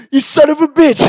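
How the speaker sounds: high, loud mock-angry yell; furious outburst. Slapped friend wakes and catches them, angry yell. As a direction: imitate an outraged victim shouting.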